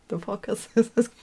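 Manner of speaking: high, incredulous what-the; mock astonishment. Seeing goats in a car boot, incredulous. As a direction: squeak out the disbelief.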